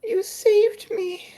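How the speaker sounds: very high, slow and breathy, barely holding on; a frail girl gasping out her thanks; high thin voice of a rescued girl; faint rescued girl. Acted. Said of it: "she's going to go".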